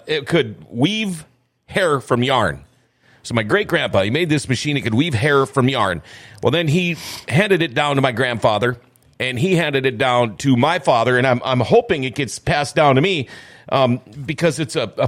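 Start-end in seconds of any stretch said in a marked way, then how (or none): none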